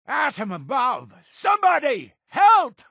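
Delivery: high-pitched shout for help; alarmed cry. Acted. Calling on Atom and anybody for help, high alarmed shout. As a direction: cry out in alarm to anyone near.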